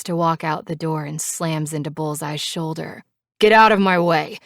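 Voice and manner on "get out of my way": loud snapping command; angry order. A narrator's reading. Knives storming out past Bullseye, loud angry. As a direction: shove the words past him.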